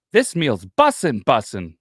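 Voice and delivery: put-on high, loud, slow kid voice; imitating six-year-old. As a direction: play a proud little kid.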